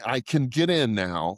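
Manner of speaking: ordinary talk, nothing marked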